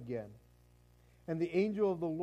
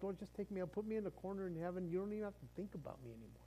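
softer, fast mumbled giving up; quiet resigned plea; 11 dB quieter, much faster than usual. Retold or imitated. Tired believer quietly asking God to take him, fast and soft. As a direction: mutter it like you're done. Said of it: "there's been times like".

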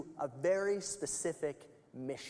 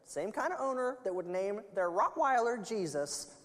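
parrot voice; 5 semitones higher than usual. Acted. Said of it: "the parrot said".

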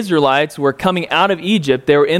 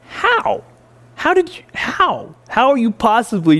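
incredulous; 7 semitones higher than usual. Acted. Incredulous at Israelites doubting God.

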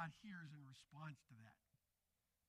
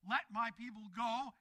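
loud declaration; commanding; 3 semitones higher, 9 dB louder than usual. Acted. Preacher as Moses demanding Pharaoh free the people, commanding. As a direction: proclaim it as a demand.

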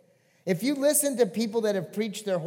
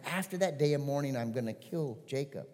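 ordinary delivery

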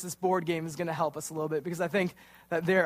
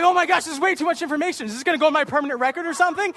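high, loud, fast panicked babble; higher, louder, rushed mock panic at the police questions; 9 semitones higher, 6 dB louder, faster than usual. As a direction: rattle it off in a rising panic. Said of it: "I'm freaking out now".

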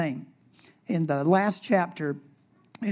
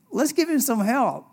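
higher, pleading take; 6 semitones higher than usual. Pretend. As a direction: beg for help on his behalf. Quoted then restated plainly.

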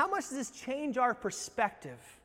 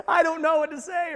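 1 higher mock panic; 9 semitones higher, 10 dB louder than usual. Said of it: "You're freaking out".

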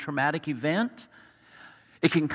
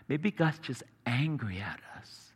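softer, lower, half-whispered worry; hushed private thought; 4 semitones lower, 7 dB quieter than usual. Acted. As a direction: let the doubt sink toward a whisper. Voicing a sufferer's private fearful thought.

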